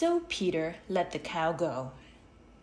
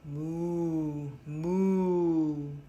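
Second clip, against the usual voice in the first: cow mooing. A narrator's reading. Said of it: "no cow said".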